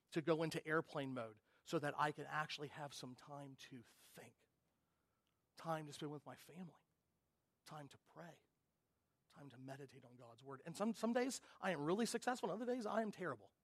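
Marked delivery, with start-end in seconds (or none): none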